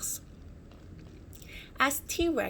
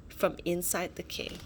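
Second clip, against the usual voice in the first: ordinary delivery, nothing marked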